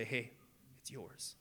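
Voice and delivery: near-silent, choked-up surrender; getting emotional. Real, not acted. Pastor to congregation, choking up with real emotion. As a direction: voice giving way with emotion. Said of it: "Yeah, I'm getting emotional".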